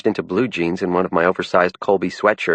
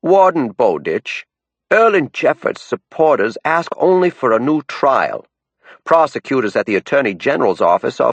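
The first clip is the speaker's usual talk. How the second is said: reciting a rehearsed campaign speech; well-practiced speech; 5 semitones higher than usual. A narrator's reading. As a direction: down east Maine accent, declaiming. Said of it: "launched into what sounded like a well-practiced speech".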